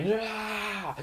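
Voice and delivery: sudden high yelp; a quick high cry as the lightning hits; mock pain cry. Jedi hit by lightning.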